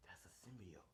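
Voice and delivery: whisper. Acted. Life Foundation watching on TV.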